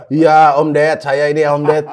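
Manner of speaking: playful celebrity impression, lifted and loud; [name] voice. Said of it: "udah jelas suara-suara dia".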